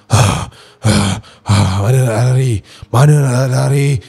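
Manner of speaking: loud, breathy, menacing rasp; hoarse possessed voice. Retold or imitated. Possessed child taunting mother trapped in room, hoarse rasp. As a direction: taunt hoarsely like a demon on the hunt. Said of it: "Suara dia memang garau gila".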